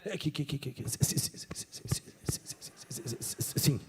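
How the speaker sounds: barely voiced, stuck on si- before sim; stuttering Moses. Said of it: "É isso, Moisés?".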